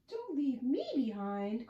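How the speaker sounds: lightly acted call from the vampire. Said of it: "calls the vampire".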